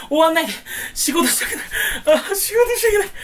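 high, teary hammed-up whine; mock whining cry. Unemployed vlogger whining about having to work, high mock sob. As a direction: wail like a kid refusing chores.

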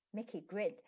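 usual speaking voice

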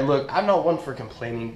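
usual speaking voice

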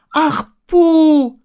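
very high, loud wail; crying out. A narrator's reading. Boy crying out for missing Pooh, high loud call. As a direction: call out in dismay, high and long. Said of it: "schrie Christopher Robin".

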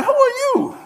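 squeaky high disbelieving "Who are you?"; mock dismissive. Israelites snub Moses when he arrives, high mock disbelief. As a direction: toss it off way up high like you don't recognize him. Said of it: "they like".

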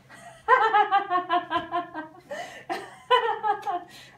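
long loud high laugh; big burst of laughter. Real, not acted. Trainer bursting out laughing at the puppy's antics. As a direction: crack up completely.

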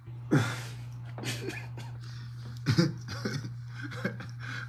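real wheezing laughter; breathless laugh. Real, not acted. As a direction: laugh till the breath runs out. Cracking up over Marsha.

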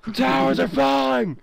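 high panicked shout; yelling while running away. Acted. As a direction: scream it like a disaster movie. Towers collapse in game, panic.

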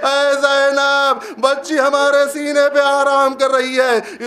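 keen it from the severed head's voice; a father's voice hushing his sister, high and grieving; mournful call. Acted. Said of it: "یہ آواز آئی".

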